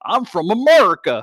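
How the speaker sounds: high, loud mock-American voice; hammed-up "I'm from America". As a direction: lay on an Irish take of an American. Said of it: "making fun of American accents".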